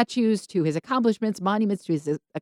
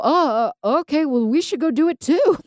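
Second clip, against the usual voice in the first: higher, louder mock-flustered voice; stumble, then pipe up with sudden eagerness; hesitant bluster; 9 semitones higher, 5 dB louder than usual. Saul hearing his son is fighting, deciding to join, in mock-flustered eagerness. Acting Saul belatedly joining in.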